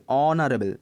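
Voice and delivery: অনারেবল demonstrated with silent H. Shown as a demonstration. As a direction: say অনারেবল with no H at the front. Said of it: "এইচের উচ্চারণটা হচ্ছে না".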